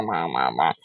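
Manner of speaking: usual speaking voice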